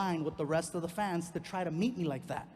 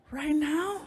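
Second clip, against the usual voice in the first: put-on high incredulous girlfriend; 9 semitones higher, 4 dB louder than usual. Girlfriend woken at 3 am.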